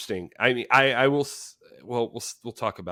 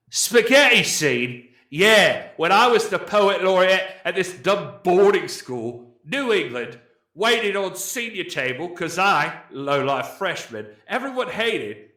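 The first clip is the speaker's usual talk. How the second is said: put-on loud, higher British accent; 5 semitones higher, 4 dB louder than usual. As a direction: read it like a brash British lad. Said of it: "I'm gonna read it in a British accent".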